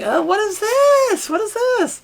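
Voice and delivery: put-on very high, drawn-out child voice; high-pitched mock child alarm; startled child. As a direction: squeal it slowly like a bewildered kid. Voices startled child seeing parents.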